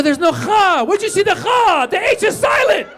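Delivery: very high mock-outraged yelling; exasperated outburst. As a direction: shriek in comic exasperation at their mistake.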